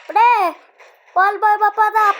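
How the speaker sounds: loud excited call to the viewers; excited shout. Real, not acted. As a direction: shout it out with hype. Excited gamer calling out.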